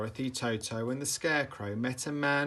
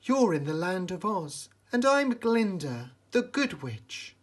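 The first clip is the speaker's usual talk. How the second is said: high, sweet good-witch voice; a gentle fairy-tale witch, pitched up; good witch Glinda; 8 semitones higher, 4 dB louder than usual. A narrator's reading. Glinda welcoming lost Dorothy, high and sweet. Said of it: "replied Glinda".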